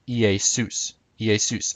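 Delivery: Iesus said ee-ay-SOOS; biblical Greek pronunciation. Shown as a demonstration. Teacher shows biblical Greek, Iesus as ee-ay-SOOS. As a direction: say Iesus with a middle ay and stressed soos. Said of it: "Jesus would have been pronounced".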